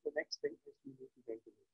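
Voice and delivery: faint, far from the mic; barely audible. Real, not acted. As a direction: voice fading almost to nothing. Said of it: "your voice is very low".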